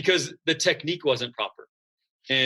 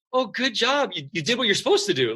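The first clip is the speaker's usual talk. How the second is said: high, sing-song empty praise for "good job"; hollow praise; 8 semitones higher than usual. Retold or imitated. Teacher to student, fake gushing praise for doing the minimum. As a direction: gush over nothing like a babysitter teacher. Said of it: "treat you like that".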